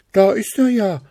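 high, loud excited call. A narrator's reading. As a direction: shout it as you rush forward. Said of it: "riefen die Hasen".

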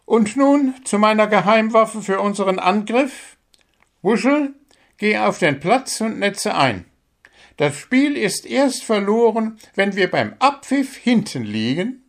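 a bird coach praising and correcting a player; small bird coach. Acted. Coach bird giving the squirrel feedback.

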